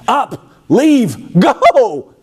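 high, curt commands from Pharaoh; commanding Pharaoh. Acted. Pharaoh orders the Israelites out, high and curt. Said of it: "he just says three things".

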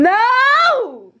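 long, high, loud "no"; real exasperated yell. Real, not acted. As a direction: cut them off with a drawn-out high shout. Sibling quarrel, cutting off excuse.

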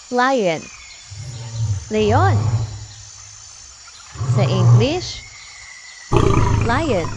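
put-on children's teacher calling out words; teacher vocabulary drill. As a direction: announce each animal name to little kids.

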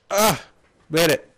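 high, loud burst of relief; triumphant shout. Real, not acted. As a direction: yell it out in triumph. Risky jump succeeds.

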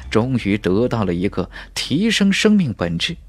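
usual speaking voice

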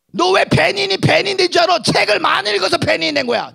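high, loud scolding shout; berating aunt. Retold or imitated. Aunt shouting at nephew about becoming Benny, scornful. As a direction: tear into him with contempt.

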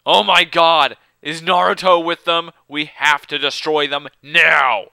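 over-the-top alarmed shout, ending on a long NOW; mock alarm. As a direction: play up the panic at full volume.

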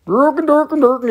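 high, loud mock-Swedish Muppet gibberish; Swedish Chef. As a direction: burble nonsense like a Muppet chef. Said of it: "Here's your Swedish chef like a Muppet".

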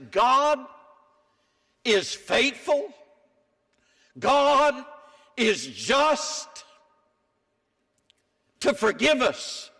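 preacher declaring the promise; preaching proclamation. Acted. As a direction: proclaim each word to the back row.

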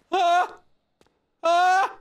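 high loud startled screams; terrified yelps; short scream. Real, not acted. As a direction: scream at the jump scare. Jump scare screams.

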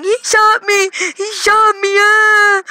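high-pitched crying yell; mock screaming. Imitating self at eight running inside shot, high mock scream. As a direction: imitate a kid yelling for help. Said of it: "me yelling".

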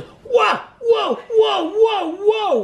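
very high, loud repeated yelps; comic howls of pain, pitched far up; mock pain yelps. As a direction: re-enact yelping under a painful jab. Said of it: "it really hurt".

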